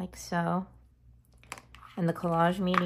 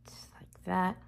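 soft whisper while working; 3 semitones higher, 3 dB quieter than usual. Real, not acted. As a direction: whisper it to yourself.

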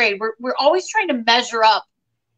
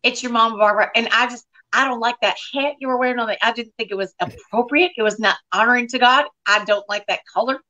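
Southern mom impression, primly disapproving; Barbara. Barbara scolds her daughter about her hat, Southern mom impression. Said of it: "I can do my mom".

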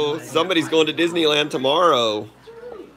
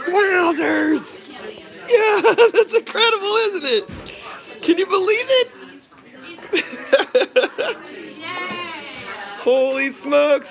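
put-on much higher sing-song talk to a child; baby talk; 13 semitones higher than usual. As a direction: coo at the birthday girl, all squeaks. High baby talk to daughter.

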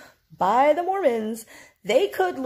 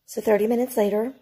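ordinary talk, nothing marked

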